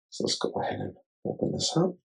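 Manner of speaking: fast, breathy whisper to himself; quick whispered self-talk; whispered aside. Real, not acted. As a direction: murmur it under your breath while busy. Aside while fumbling the phone.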